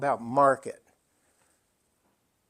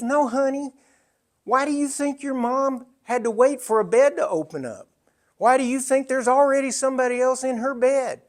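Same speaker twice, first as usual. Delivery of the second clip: higher, lightly voiced nurse calling him honey; a hint of a sweet but firm Southern nurse; Southern head nurse; 9 semitones higher, 4 dB louder than usual. Head nurse setting a grieving son straight, lightly voiced higher. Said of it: "That's exactly the way she said it".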